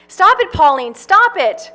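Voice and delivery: high, loud cry of stop it; pleading shout. Acted. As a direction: beg her to stop, shouting. Farley begs over crying.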